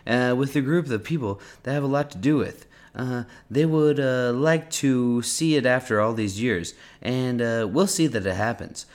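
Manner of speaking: high-pitched, swinging presidential bluster; Donald Trump impression. Reading an interview transcript to the co-host as the president, in a Trump accent. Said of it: "in a Trump accent".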